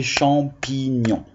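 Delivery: "champignon" in three parts, cham-pi-gnon; "champignon" with a small break after each syllable; split into syllables. Shown as a demonstration. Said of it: "trois syllabes orales aussi".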